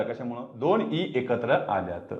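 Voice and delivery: ordinary talk, nothing marked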